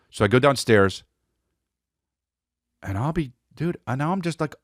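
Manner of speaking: usual speaking voice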